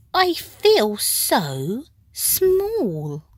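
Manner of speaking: high, very slow, tiny voice; small voice. A narrator's reading. Snail telling the whale she feels small, high and slow. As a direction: sound tiny and awed, drawn out.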